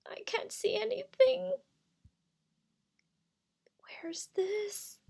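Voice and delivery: very high, thin frightened voice. Acted. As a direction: call out lost in the dark. Crystal frightened in sudden darkness.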